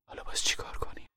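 very soft despairing whisper; weary, heartsick mother. Acted. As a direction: whisper it to yourself, worn down. (نجوای آهسته و درماندهٔ مادری خسته)

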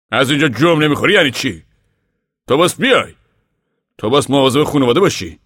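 father loudly ordering his defiant wife to come; angry father. Acted. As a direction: scold her to make her come. Pa argues with defiant Ma.

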